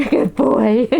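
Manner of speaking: delighted laugh at the dog. Real, not acted.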